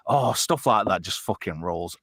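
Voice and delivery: normal talking voice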